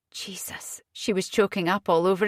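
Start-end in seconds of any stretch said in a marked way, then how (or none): 0.1-0.8 a breathy whispered curse to herself. A narrator's reading. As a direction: breathe it out, almost silent. Inner thought, choking up.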